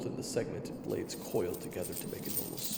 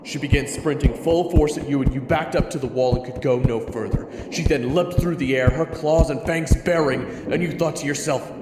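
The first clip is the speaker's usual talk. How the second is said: loud, higher, urgent storytelling; urgent loud narration; 5 semitones higher, 14 dB louder than usual. A narrator's reading. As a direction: drive the attack scene at full force. Narrator at climax of the attack.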